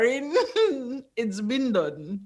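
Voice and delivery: laughing. Real, not acted. Teasing reveal through laughter.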